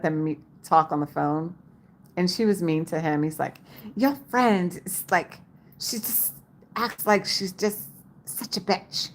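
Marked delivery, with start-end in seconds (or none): 4.0-9.1 slow, halting imitation of a British friend; slow, lightly mock-British delivery; British accent. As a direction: mimic his British complaint, drawn out. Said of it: "he has a British accent".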